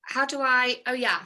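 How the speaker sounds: normal talking voice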